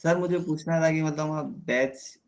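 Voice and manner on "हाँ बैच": usual speaking voice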